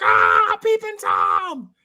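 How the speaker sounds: high, drawn-out shriek; stretched-out hammed-up scream of alarm; mock woman's scream. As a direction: imitate a startled woman screaming. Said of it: "and she screams".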